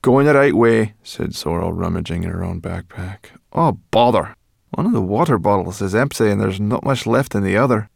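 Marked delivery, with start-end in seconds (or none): 0.0-0.9 high, loud curt answer; raised, offhand character voice; Sorrel, brusque companion. A narrator's reading. 3.5-7.9 slightly raised grumbling voice; brash, put-out grumble; Sorrel, irritated companion. A narrator's reading.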